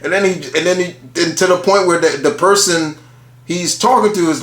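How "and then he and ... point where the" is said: ordinary delivery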